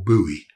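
"Bowie" as BOO-ee; alternative pronunciation. Shown as a demonstration. Knife maker listing ways to say it, "Bowie" as BOO-ee. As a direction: say "Bowie" with an OO as in "boot". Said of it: "I've heard it pronounced so many different ways".

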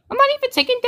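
high, quick protest with cracking voice. Real, not acted. Gamer baffled at taking no damage, voice cracking. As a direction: let the voice crack upward in surprise. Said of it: "Voice crack".

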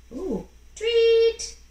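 high, loud tweet; excited tweet. Acted. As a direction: squeal it like a newborn owl. Owl chick hatches.

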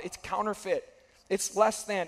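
usual speaking voice

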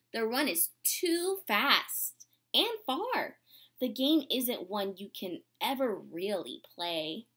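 gentle, unhurried storybook reading; reading to children. Acted. Teacher reading a storybook to her class, gentle and unhurried. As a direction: read it like a picture book to the class.

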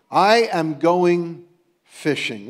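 slowly and weightily, each word drawn out; slow, deliberate quote. Retold or imitated. Quote repeated slowly for reflection.

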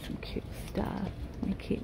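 soft whisper to herself; whispered aside. Real, not acted. Browsing baby clothes, whispering to herself. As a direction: breathe it out under your breath.

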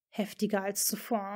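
plain reading voice

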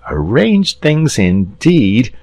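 mild bitter mutter. Acted. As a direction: repeat it with sour scorn. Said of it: "he said bitterly".

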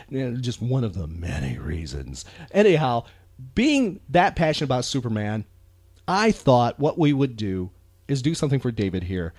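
ordinary delivery

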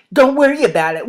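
emphatic brush-off, pitched moderately high; dismissive. Acted. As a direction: brush off the warning forcefully. Dismisses the warning that is echoed.

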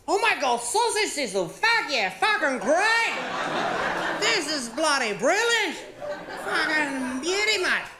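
hammed-up Australian bloke cheering; Aussie ocker. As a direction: gush like a thrilled local at Bunnings. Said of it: "I was like".

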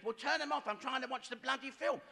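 high-pitched, irritated demand; mock exasperation. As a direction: play a fed-up spouse snapping the order. Husband and wife quarrel, acted.

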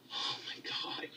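breathless whispered "oh my god"; exasperated whisper; whispered despair. Real, not acted. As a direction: whisper it in defeat. Despair during rant.

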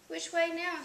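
lightly acted cry for direction. A narrator's reading. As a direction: call it out, a touch lost. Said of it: "Hugo cried".

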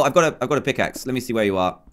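ordinary delivery

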